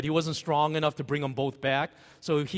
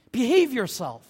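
louder, higher mock reprimand; acted scolding; 6 semitones higher, 5 dB louder than usual. Crowd tells the shouting beggar off, acted scolding. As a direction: scold as the embarrassed crowd would.

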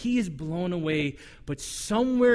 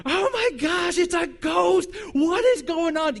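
high, loud terrified yelling; loud, high panic from a man on a boat in the night; panicked shout; 9 semitones higher, 4 dB louder than usual. Acted. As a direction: scream like you've just seen a ghost. Disciples see a ghost.

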